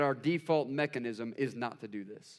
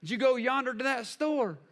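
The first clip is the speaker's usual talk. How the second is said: high-pitched Southern country talk; Southern accent; 8 semitones higher than usual. Shown as a demonstration. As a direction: put on a folksy Southern accent. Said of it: "saying words like".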